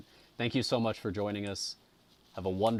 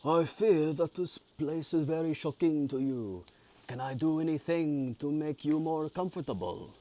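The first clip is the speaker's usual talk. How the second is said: high, louder attempt at a French accent; bad French accent; 9 semitones higher, 5 dB louder than usual. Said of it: "French accent, I guess?".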